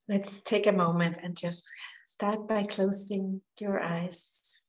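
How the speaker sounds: soft meditation voice with long pauses; guided meditation. Real, not acted. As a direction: gently guide them into relaxing, letting phrases fall away quietly.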